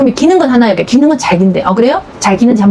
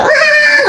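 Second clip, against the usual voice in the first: imitated baby wail; much slower than usual. Expert imitates the baby bawling when set down, high mock wail. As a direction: let out a baby's bawl.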